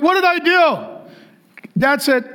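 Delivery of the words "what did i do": high, drawn-out protest of innocence; imitated bewildered kid. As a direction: play a hurt child pleading with dad. Kid questions the yelling dad.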